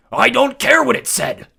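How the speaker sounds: very loud furious bellow, slow. A narrator's reading. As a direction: roar her down. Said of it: "Ares bellowed".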